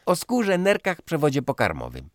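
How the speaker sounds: high, loud teasing list; mocking young girl. Daughter teasing the old man, high and loud. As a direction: reel off the organs to provoke.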